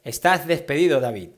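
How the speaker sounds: loud firing announcement, spat out; angry boss. Acted. Boss firing David, loud and angry. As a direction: boom it like a furious boss. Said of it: "Mi jefe me dijo".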